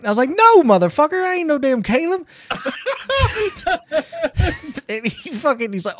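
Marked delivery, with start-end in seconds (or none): 0.3-2.2 loud, high comic outrage; retold indignant denial. Diner mistaken for Caleb to waiter, loud indignant denial. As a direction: protest loudly, pitched way up.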